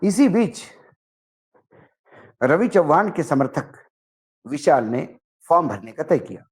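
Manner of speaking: ordinary delivery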